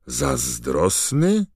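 slow, high rising disbelief; a drawn-out incredulous question; surprised. A narrator's reading. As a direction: echo the word back in astonishment. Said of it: "zdziwił się Harry".

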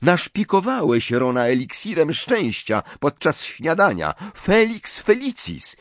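high-pitched reproach; indignant accusation. A narrator's reading. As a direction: accuse him, pressing the point. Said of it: "żachnęła się Hermiona".

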